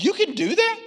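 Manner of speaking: can't believe it, voice leaping up; husband stunned his wife learned it online; amazed disbelief. Retold or imitated. Amazed at YouTube fix.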